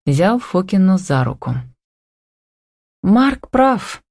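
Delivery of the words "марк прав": higher, muted-timbre thought; inward sigh. A narrator's reading. Tanya silently agreeing with Mark, sighing inwardly. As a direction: sigh it lightly as a private thought. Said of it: "мысленно вздохнула она".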